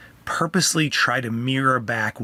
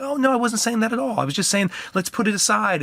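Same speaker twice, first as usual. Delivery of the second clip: reassuring dad; 8 semitones higher than usual. Retold or imitated. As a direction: gently reassure the crying son. Dad reassuring upset child.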